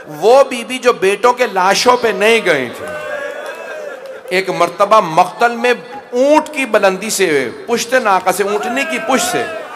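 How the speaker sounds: rising impassioned sermon cry; full-force preaching. Acted. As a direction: build the grief to a peak.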